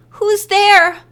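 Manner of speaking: a high, nervous call from behind a door; small, timid. A narrator's reading. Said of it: "Carrie's voice sounded small and timid".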